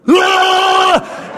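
high, loud tongue-flapping yell; drunken yell at camera. Retold or imitated. Drunk man yelling into the police camera, high and loud. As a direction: blast a sloppy drunk yell. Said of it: "I look at the camera like this".

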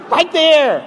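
high, loud exclamation; acted-out shock; alarmed pointing-out. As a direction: insist on it in alarm. Acts out coworker repeating alarm.

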